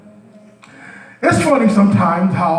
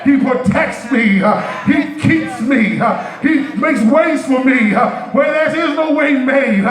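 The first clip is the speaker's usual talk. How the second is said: building sermon; 5 semitones higher than usual. Acted. Preacher declaring God's provision, rising preaching. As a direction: drive each line home to the church.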